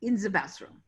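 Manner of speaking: "In the bathroom" with a thick German accent, th as s, b as v; lightly mocked German accent, "bathroom" said as "vastroom". Shown as a demonstration. Casting director demos the one-line role in a German accent. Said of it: "it would sound something like".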